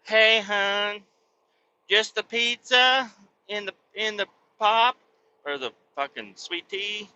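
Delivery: high, sweet cashier impression; lightly voiced cashier asking about pop or sweet tea. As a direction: put on a chirpy cashier voice. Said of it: "she's like".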